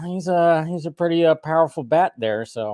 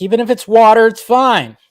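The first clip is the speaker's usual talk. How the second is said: higher, louder reassurance; excited; 10 semitones higher, 9 dB louder than usual. Real, not acted. Host telling viewers any drink is fine, excited. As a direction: bounce it up, eager.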